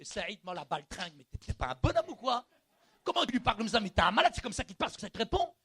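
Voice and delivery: loud, rising self-rebuke; shouting down his own pride; scolding shout. Retold or imitated. Il y avait un combat.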